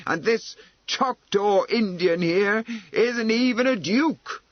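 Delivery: forceful. Acted. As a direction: lay into them, unable to hold back. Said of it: "said forcefully".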